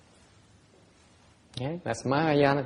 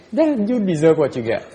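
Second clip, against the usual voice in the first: louder, blunt comeback; blunt retort; 3 semitones higher, 7 dB louder than usual. Retold or imitated. Teacher retelling his answer to the stubborn student. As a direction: shrug it off bluntly. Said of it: "I say".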